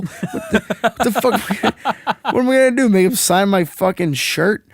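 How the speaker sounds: high-pitched, laughing mock outrage; exasperated son cracking up, voice climbing high; laughing exasperation. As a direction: protest incredulously through laughter. Mock outrage at dad's autograph request.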